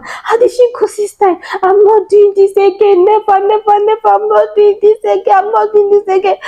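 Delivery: high-pitched pleading wail; re-enacted wail of a woman in labor; retold labor scream. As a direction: cry out, swearing off childbirth. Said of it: "I started screaming".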